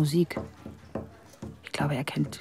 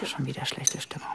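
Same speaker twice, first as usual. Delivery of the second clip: whisper; 5 dB quieter than usual. Acted. Inner thought at tense dinner.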